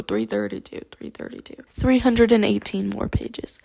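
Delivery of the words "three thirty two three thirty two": hushed, fading repetition; muttering to oneself. Real, not acted. Vlogger checks her page count, muttering to herself. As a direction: murmur the number as you work it out.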